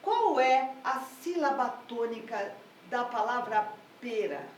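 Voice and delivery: usual speaking voice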